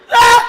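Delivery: high, very loud sudden scream; imitated holler. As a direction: mimic your brother hollering at full volume. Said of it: "what the fuck he hollering for".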